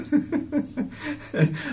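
a high, airy laugh after the punchline; amused at his own tease, laughing freely. Real, not acted. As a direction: burst out laughing.

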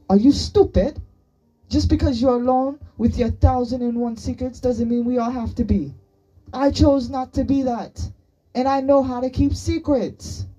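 loud, high-pitched berating; angry tirade. A narrator's reading. Boy berating his friend over secrets, angrily shouting. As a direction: lay into them at full voice.

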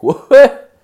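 high, loud squawk of disbelief; shocked what. Retold or imitated. Retelling the dispensary guy, a loud shocked yelp. Shocked reaction to weird joint.

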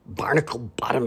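whispered grumble; grumpy old sailor muttering; muttered curse. Acted. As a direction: mutter it under your breath. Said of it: "Swashby muttered".

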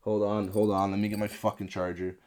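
ordinary delivery

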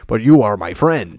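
mock-solemn character imitation; stilted, earnest declaration, hammed up; Knuckles. Mocking how the movie character declares friendship, stilted put-on voice. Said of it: "He doesn't talk like that".

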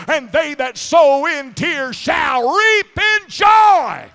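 rousing preacher's climax, last words stretched out; full-force preaching. Real, not acted. Sermon builds to full-force climax.

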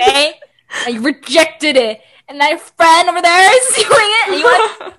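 loud, rising mock scolding with laughter; mad, retold. Retelling telling off brother over rejected code, laughing exasperation. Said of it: "I got so mad".

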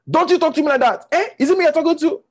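loud, high indignant outburst; wounded pride exploding into a shout; losing their temper. Acted. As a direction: lash out, offended, voice shooting up. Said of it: "they lose their temper".

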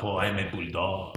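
soft, low mock French accent. Podcaster voices a bulldog in a beret, soft French accent. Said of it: "I love your French accent".

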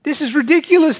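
high, loud indignant outburst; exasperated. A narrator's reading. Man left holding the rope, thinking to himself, exasperated. Fable's man protests after being pulled.

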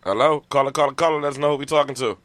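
loud, bright radio host greeting; radio host hype. Acted. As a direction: greet the caller like a DJ.

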